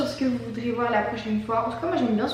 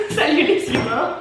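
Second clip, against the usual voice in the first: salut en riant; 4 semitones higher, 5 dB louder, slower than usual. Real, not acted. Outro en riant.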